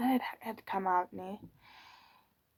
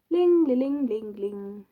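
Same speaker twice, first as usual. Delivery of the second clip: phone ringing; 10 dB louder, slower than usual. Retold or imitated.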